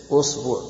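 أصبع with a u on the hamza and on the ba; variant pronunciation usbu'. Shown as a demonstration. Teacher shows students the variants, أصبع said "usbu'". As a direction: say أصبع as "usbu'", u in both syllables. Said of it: "أي وجه تقرأه ما تغلط".

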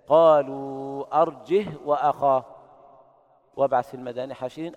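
chanted أرجه ending on a still ه; silent ha. Shown as a demonstration. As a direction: recite أرجه as arjih with a silent ه. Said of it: "بدون همز وإسكان الهاء".

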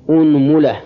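أنملة as "unmula", u after hamza and mim; damma on both. Shown as a demonstration. Teacher starting the damma set, أنملة as "unmula". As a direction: say أنملة with opening u and middle u. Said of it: "ضم الهمزة".

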